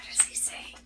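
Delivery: put-on hushed secret whisper to the kids; playful whisper. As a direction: whisper it like a little mystery. Playful suspense to young listeners.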